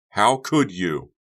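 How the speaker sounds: slow, loud and hurt; accusing. Acted. Becca accuses Honey, slow loud and angry. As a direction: drag out the accusation.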